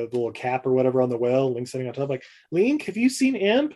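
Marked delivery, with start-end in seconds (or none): 2.5-3.7 high, questioning call; lightly voiced woman asking. Woman asks Link where Imp went, high questioning voice. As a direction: ask suspiciously.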